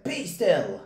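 high, loud command to the monsters. A narrator's reading. Max taming the wild things, loud command. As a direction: order them to freeze. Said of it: "Till Max said".

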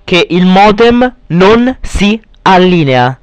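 ordinary talk

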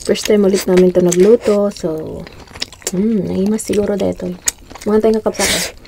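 chewing while talking; muffled by food in mouth; mouth full. Real, not acted. Seen 0: Right speaker's cheeks bulge, food on lips, chewing throughout.

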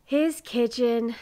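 slow, drawn-out sigh on the words. A narrator's reading. Neighbor appalled at the messy kitchen, sighing. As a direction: sigh it out slowly in dismay. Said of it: "sighed Miss Pitcher".

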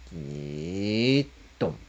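creaking door sound. Retold or imitated.

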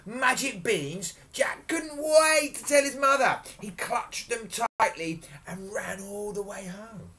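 high, excited storyteller delivery; animated storytelling. A narrator's reading. Storyteller telling children of Jack's beans, animatedly. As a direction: read it to children with big emphasis.

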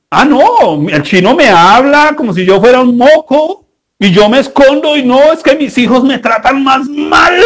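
lightly voiced whining mother, rising to a mock shout; mock complaining parent. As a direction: play the self-pitying mom, building to a wail.

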